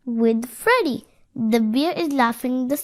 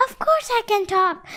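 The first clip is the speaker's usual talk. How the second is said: put-on high-pitched little boy answering; reply as a small child, pitched up; high storybook child voice; little boy's voice; 9 semitones higher than usual. A narrator's reading. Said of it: "the voice replied".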